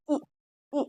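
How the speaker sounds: ई said quickly, as a short vowel. Shown as a demonstration. Teacher showing learners the short vowel, ई said quickly. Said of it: "जल्दी से बोलेंगे छोटी ई".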